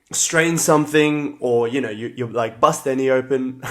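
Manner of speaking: ordinary talk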